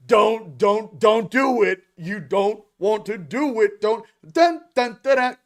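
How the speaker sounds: high, loud mock warning; star seeds pleading. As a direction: plead with them not to, hammed-up. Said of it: "all the star seeds are like".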